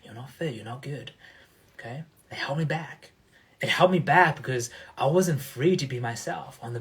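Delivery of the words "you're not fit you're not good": soft self-critical inner voice; the voice of shame in his head, spoken softly; hushed inner voice. Retold or imitated. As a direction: murmur the doubts to yourself, much softer.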